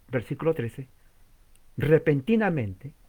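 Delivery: ordinary delivery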